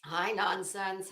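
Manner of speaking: normal talking voice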